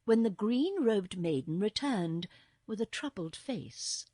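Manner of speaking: plain reading voice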